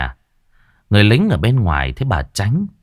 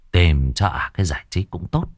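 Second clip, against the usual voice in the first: ordinary delivery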